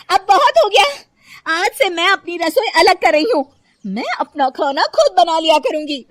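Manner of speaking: loud, high, fed-up declaration; explode, you have had enough; very angry outburst. Acted. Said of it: "कल्पना को बहुत गुस्सा आ जाता है".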